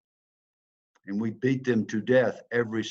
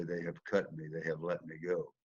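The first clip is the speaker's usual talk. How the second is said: dejected hush; 4 semitones lower, 10 dB quieter than usual. Retold or imitated. Imagined dejected call to girlfriend.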